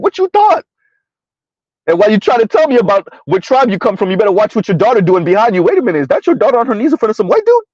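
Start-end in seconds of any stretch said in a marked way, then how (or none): 0.0-0.6 much higher, sassy what-you-thought; mock incredulous. Retort to Africans surprised by racism, much higher sass. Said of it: "I'm like". 5.6-7.6 fast, higher, mock shocked; mock surprise. Taunting a Nigerian father, mock shocked and fast.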